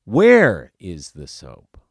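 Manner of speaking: Where punched very loud and slow; Where is the soap dragged out and shouted; overdone stress. Shown as a demonstration. Coach mocking actors, hammy slow loud Where. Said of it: "I'm gonna screw it up here".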